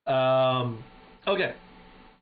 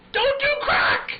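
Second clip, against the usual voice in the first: mock shout; 5 dB louder, slower than usual. Shouting after leaving crack smokers.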